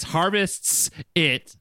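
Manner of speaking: "Harvests" spelled out slowly, leaning on the final s; slowly sounding out "Harvests it" to get the s right; slow careful pronunciation. Shown as a demonstration. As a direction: drag out "Harvests it", every sound clear. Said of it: "But it's like with an S".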